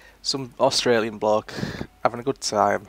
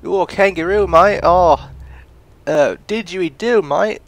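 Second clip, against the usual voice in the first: louder, high-pitched mock-Aussie exclaiming; bad Australian accent; 6 semitones higher, 7 dB louder, slower than usual. Riffing on kangaroos and didgeridoos, hammed-up Australian accent. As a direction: blurt Aussie clichés in a clumsy Australian accent. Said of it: "I can't do the Australian accent very well".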